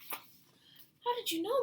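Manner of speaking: put-on high, loud and slow wondering little girl; drawn-out high child question; surprised child. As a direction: ask in amazed child wonder. Child asks neighbor, surprised.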